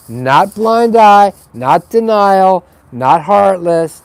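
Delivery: insist, punching every "not"; emphatic denials. Real, not acted. To the viewers, clarifying what he means, slow and emphatic.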